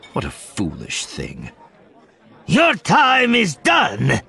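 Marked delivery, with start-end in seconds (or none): 2.5-4.2 slow, loud, shaky defiant growl. A narrator's reading. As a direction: growl it out, voice trembling. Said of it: "the Twi'lek growls in a shaky voice".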